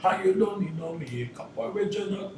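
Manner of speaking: toast it in a light Jamaican style. Shown as a demonstration. Artist showing his reggae verse in Jamaican style. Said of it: "Jamaican style".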